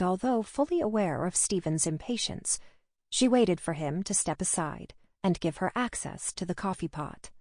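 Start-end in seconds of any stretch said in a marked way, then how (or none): none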